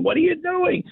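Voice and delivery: mock a mother screaming from upstairs; imitated maternal scream. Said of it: "You screaming?".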